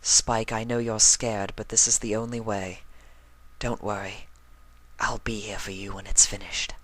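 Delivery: soft, fading to a whisper; tender reassuring whisper; tender comfort. A narrator's reading. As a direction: soothe him gently, almost whispering. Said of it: "Rarity nuzzled against him".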